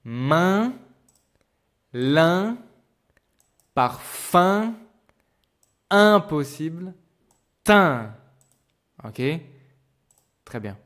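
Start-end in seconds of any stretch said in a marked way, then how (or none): 0.0-8.1 main, lin, parfum with loud rising nasal vowels; teacher reading example words. Shown as a demonstration. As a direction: say each word slowly with the nasal vowel ringing. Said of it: "repeat after me".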